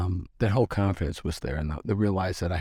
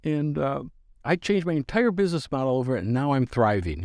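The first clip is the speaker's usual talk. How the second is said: the lady insisting; 7 semitones higher than usual. Retold or imitated.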